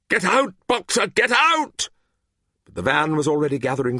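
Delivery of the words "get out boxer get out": high, loud urgent cry; crowd's cry. A narrator's reading. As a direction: shout the warning after the van. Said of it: "took up the cry".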